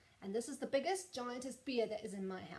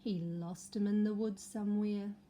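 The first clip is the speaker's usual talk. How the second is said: ordinary delivery, nothing marked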